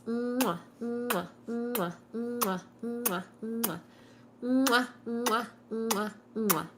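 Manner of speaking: a string of smacking kisses; blown kisses. Real, not acted. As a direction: blow goodnight kisses at the camera. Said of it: "now for the goodnight kisses".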